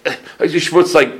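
imitating a Yiddish-speaking elder; Yiddish-speaking rosh yeshiva. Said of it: "Then he says to me".

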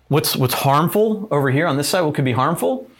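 ordinary talk

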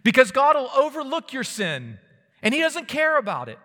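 high, loud pulpit cry; preaching at full force. Acted. Preacher voicing a false message, full-force preaching.

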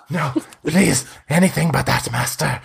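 high, pleading and wretched; a starving vampire begs his master for release; starved pleading vampire. Acted. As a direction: beg like a starving creature locked away. Spawn begs not to return.

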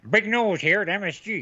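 put-on old-timey newsboy calling headlines; 1920s newsboy voice. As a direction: bark it like a street newsboy. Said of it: "that was actually really good".

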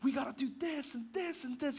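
high, fired-up push for the plan; hammed-up self-imitation of getting worked up; passionate outburst. Pastor mocking his own heated debating, fired up. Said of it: "No matter how passionate I get".

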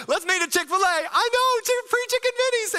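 high, loud, giddy call to friends; excited friend. Pretend. Friend calling everybody about free chicken minis, giddy and high. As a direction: squeal it like free food just dropped.

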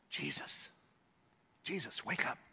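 hushed, polite whisper to wake him; mock-timid whisper. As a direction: tiptoe up and whisper his name.

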